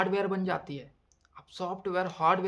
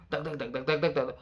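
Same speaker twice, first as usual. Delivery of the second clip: heartbeat by mouth; 3 semitones lower than usual. Retold or imitated. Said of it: "मैं मुंह से बोल रहा था".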